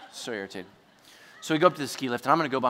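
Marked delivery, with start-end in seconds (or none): 0.1-0.7 low, soft grumble; irritated grumble. Retold or imitated. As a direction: mutter it to yourself, annoyed. Muttering his annoyance after quarrel.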